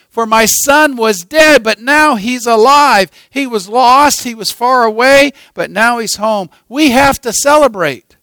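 loud, high, jubilant orders to the servants; crying out joyfully. Acted. Father shouting to the workers to prepare a feast, jubilant call. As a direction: call them out with overflowing joy. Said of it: "the father cried out to them".

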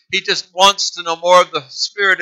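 normal talking voice

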